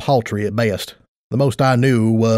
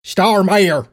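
higher, yelled name; yelling through a door; 7 semitones higher than usual. Acted. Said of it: "I yelled through the door".

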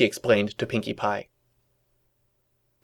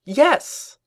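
high, loud burst of victory; triumphant yelp; 5 dB louder than usual. A narrator's reading. Twilight feeling proven right, triumphant high yelp. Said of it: "Twilight yelped out triumphantly".

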